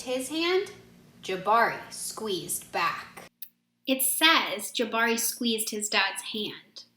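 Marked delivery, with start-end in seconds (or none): none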